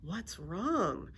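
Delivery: ordinary delivery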